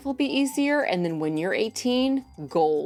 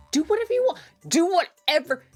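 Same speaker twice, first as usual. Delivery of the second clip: high excited outburst; 10 semitones higher, faster than usual. Real, not acted. Pitch jumps after whisper.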